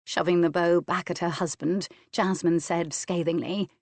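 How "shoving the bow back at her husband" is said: usual speaking voice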